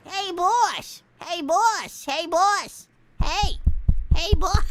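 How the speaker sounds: put-on poi boy voice. Hungry henchman begging his boss for food, whiny and loud. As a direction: nag the boss like a hungry sidekick. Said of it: "poi boy voice".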